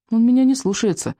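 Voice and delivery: high-pitched plaintive complaint; mildly whiny protest; complaining. A narrator's reading. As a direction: complain like a helpless child. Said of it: "пожаловался Икинг".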